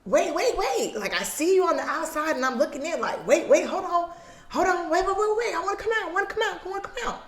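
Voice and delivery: put-on high, pleading kid voice; a trapped child begging to get out; panicking kid.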